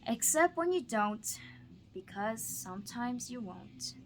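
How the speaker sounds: lively, expressive storybook read-aloud; bouncy rhyming picture-book voice; reading to children. Acted. Teacher reads Seuss to student.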